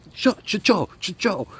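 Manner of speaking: high, sharp imitation of a jolted-awake sentry; acted-out alarmed Ukrainian shout; startled guard.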